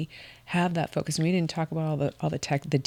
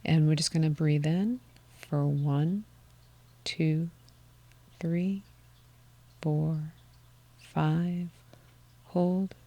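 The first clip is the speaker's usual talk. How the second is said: guided breath count; much slower than usual. Acted. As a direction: count the breath gently, letting each number hang.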